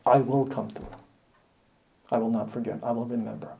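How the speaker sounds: soft, hushed voice of God; God softly promising the poor he will remember them; God's quiet promise. Acted. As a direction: reassure them gently, almost under the breath.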